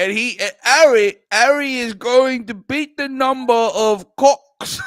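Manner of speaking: loud, hammed-up British accent; broad mock-English author voice; J K Rowling. Author decides how to tell Harry's story, broad British accent. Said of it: "say in this stupid accent".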